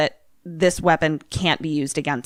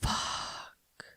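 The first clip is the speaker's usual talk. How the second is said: barely audible, drawn-out whisper; whispered curse; 16 dB quieter than usual. Real, not acted. Player horrified by the reveal, whispered curse. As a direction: breathe out the curse in dread.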